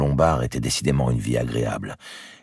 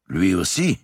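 surprised cry; 6 semitones higher, 3 dB louder than usual. A narrator's reading. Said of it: "s'écria d'Artois".

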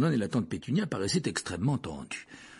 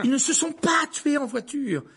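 put-on high, louder boy's retort; indignant protest; 6 dB louder than usual. A narrator's reading. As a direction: interrupt hotly, defending the parents. Boy furiously contradicts aunt. (protestation indignée, voix aiguë)

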